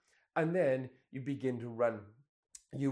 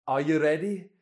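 loud imitation of a Scottish coach; Scottish accent; 2 semitones higher, 8 dB louder than usual. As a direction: call out to the runner, mimicking a Scottish accent. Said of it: "that Scottish voice again on the app".

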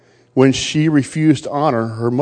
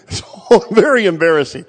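laughing; 4 semitones higher, 3 dB louder than usual. Real, not acted. Laughing at embarrassing memory.

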